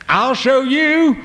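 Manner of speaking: very high, loud and slow defiant cry; boy shouting it again at his parents; mad, repeated. Acted. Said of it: "so he repeated it".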